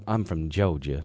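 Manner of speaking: put-on Southern belle accent. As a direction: imitate a Georgia Southern belle. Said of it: "sounds more like a Southern belle".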